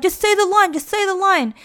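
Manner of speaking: high, pushy mock yell. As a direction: bark it like an impatient crew. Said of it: "everyone's screaming at him".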